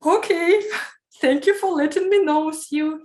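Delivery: high, bright, laughing yes to an unexpected job offer; excited, laughing thanks. Retold or imitated. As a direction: relive the thrilled surprise of the call, laughing. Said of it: "I said".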